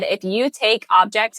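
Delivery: ordinary delivery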